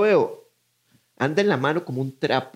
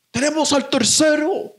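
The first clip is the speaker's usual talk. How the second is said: high, louder officer radio call; cop reporting; 12 semitones higher, 3 dB louder than usual. Retold or imitated. Officer reporting the third suspect caught, higher radio voice.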